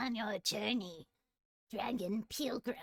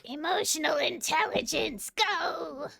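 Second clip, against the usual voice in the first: rallying shout; 6 semitones higher, 7 dB louder than usual. Acted. Kobold battle cry.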